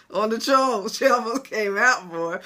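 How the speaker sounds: loud and boisterous, between laughs; amused outburst. Real, not acted. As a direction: hoot at your own near slip. Laughing at her own outburst.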